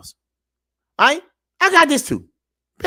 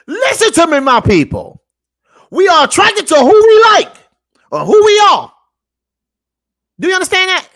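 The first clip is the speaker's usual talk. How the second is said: loud, high preaching shout, slowing at the end; fired-up preaching; 10 semitones higher, 8 dB louder than usual. Real, not acted. Vlogger preaching to his viewers, a loud shout. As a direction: drive the point home like a street preacher.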